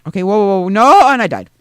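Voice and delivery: real shock as the character dies; startled dismay. Real, not acted.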